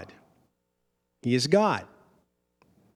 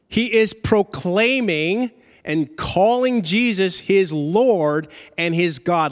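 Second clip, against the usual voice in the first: ordinary talk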